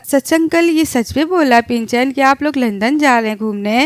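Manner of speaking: ordinary talk, nothing marked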